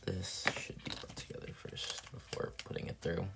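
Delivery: whispered to himself, barely there; soft whispered self-talk; whispered aside. Real, not acted. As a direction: mutter it under your breath while working. Muttering to self while crafting.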